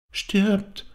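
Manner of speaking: put-on very high little child's voice; child's echo. A narrator's reading. Child repeats father's word, high little voice. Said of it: "wiederholte das Kind".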